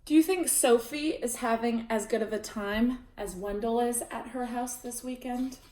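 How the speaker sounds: ordinary delivery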